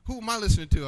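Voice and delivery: high, excited re-enacted self-talk; real excited. Man realizing his friend listens to God, excited retelling. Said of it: "I getting real excited".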